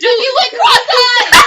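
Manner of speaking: high, very loud shriek through laughter; laughing shout. Real, not acted. Girl teasing a friend, shrieking with laughter. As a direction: shout it while cracking up.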